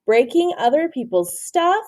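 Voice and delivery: ordinary delivery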